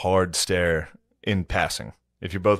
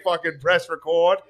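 mock Mel; 8 semitones higher, slower than usual. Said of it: "he was like".

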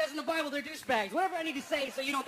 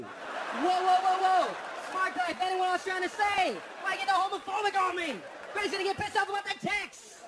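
loud, high alarmed "whoa"; shocked outburst; 7 semitones higher, 6 dB louder than usual. Retold or imitated. A man shocked by his phone's autocorrect, loud and high. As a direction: recoil at the phone in disbelief.

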